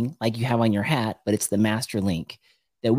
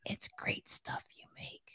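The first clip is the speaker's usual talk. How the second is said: hushed, secretive whisper; barely audible mock-secret whisper; 15 dB quieter than usual. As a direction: whisper it, imitating someone sneaking a comment at work. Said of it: "he said it like this".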